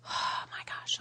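whispered aside; very soft, real amazement; hushed awe. Real, not acted. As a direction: breathe it out, overwhelmed. Overwhelmed aside to herself.

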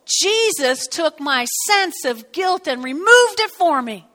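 firm rebuke to guilt; defiant retort. Retold or imitated. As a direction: push the guilt away out loud. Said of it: "and I go".

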